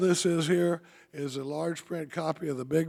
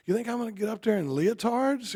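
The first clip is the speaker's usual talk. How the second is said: indignant retort; 7 semitones higher than usual. Retold or imitated. Katie objecting to wearing a coat.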